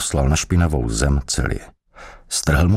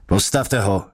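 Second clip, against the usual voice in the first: higher, mildly barked command; barked order; 4 semitones higher than usual. A narrator's reading. Interrogator orders his helper to lift the prisoner, mild bark. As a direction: call out a curt order. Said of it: "houkl vyšetřovatel na svého pomocníka".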